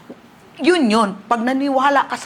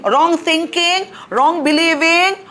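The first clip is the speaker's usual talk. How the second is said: slow, rising, ever louder cry; building preach; 7 semitones higher, 5 dB louder, slower than usual. Acted. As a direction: hammer each wrong harder. (pasigaw na pangangaral)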